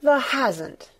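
high-pitched cry of disbelief; shocked exclamation. A narrator's reading. Martha reacting to Mary's news, shocked exclamation. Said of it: "she exclaimed".

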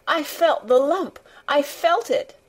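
put-on high, distressed boy; plaintive child's protest, pitched well up; choked out. A narrator's reading. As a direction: wail it in a small, upset voice. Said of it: "choked out Colin".

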